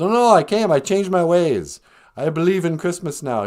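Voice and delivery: high, hammed-up protest; comic gushing, loud at the start; eager reformed Scrooge. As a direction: insist excitedly on a change of heart. Reformed Scrooge insists he changed.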